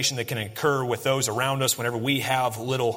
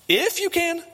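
stronger incredulous echo; 12 semitones higher, slower than usual. Retold or imitated. Jesus throwing the father's words back, even more incredulous. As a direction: push "you" up in full disbelief. That's Jesus' way of saying, You kidding me?.

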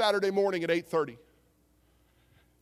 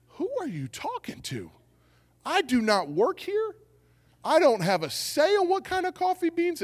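high-pitched mock indignation; hammed-up baffled objection; acted incredulous protest; 5 semitones higher than usual. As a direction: protest as if absurdly mistaken for staff. Acted indignant reply to barista.

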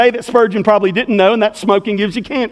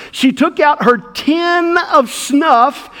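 ordinary delivery

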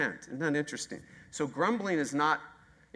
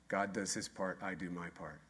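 quiet punchline; 7 semitones lower, 10 dB quieter than usual. Shown as a demonstration. Answering own question with slogan.

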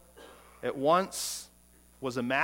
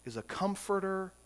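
ordinary talk

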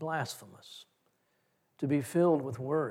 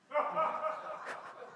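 soft breathy laugh; 12 semitones higher, 8 dB quieter than usual. Real, not acted. Recalls son's worry about college.

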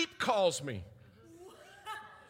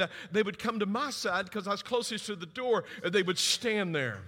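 ordinary talk

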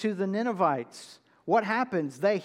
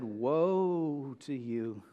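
slow, low, offhand woe; soft, drawn-out casual woe; nonchalant woe; 5 semitones lower, 4 dB quieter, much slower than usual. Shown as a demonstration. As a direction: toss it off casually, no weight. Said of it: "would not have said".

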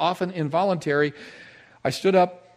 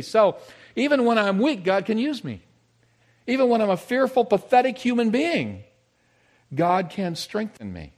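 higher, louder preaching build; preaching climax; 9 semitones higher, 6 dB louder than usual. Acted. Preacher rousing congregation, rising fervent delivery. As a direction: lift the congregation with rising fervor.

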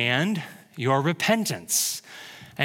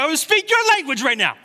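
mock yell; 11 semitones higher, 4 dB louder than usual. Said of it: "I was like".

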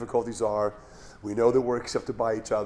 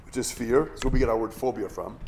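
ordinary talk

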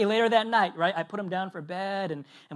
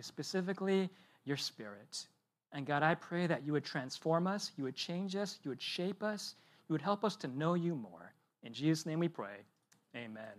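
softer, reverent prayer voice; quiet prayer; 5 dB quieter than usual. Acted. Pastor opening the sermon in prayer, soft and reverent. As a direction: pray quietly over the congregation.

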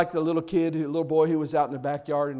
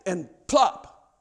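normal talking voice

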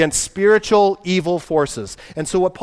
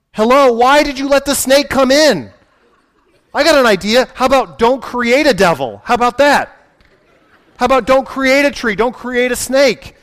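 exasperated; 5 semitones higher, 3 dB louder than usual. Real, not acted. Exasperated questioning of God.

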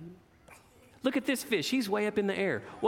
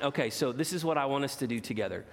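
usual speaking voice

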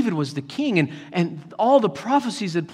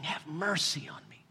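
very soft, acted supplication; quiet, humble begging; hushed plea; 3 semitones lower, 15 dB quieter than usual. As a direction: echo the plea almost in a whisper. Jesus echoes the beggar's plea.

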